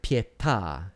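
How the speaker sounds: "pietà" with the accent on the last syllable, TÀ; stress on the final syllable. Shown as a demonstration. Lecturer gives another example of a tronco word, end-stressed "pietà". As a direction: lean on the closing vowel of "pietà". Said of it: "has an accent as well".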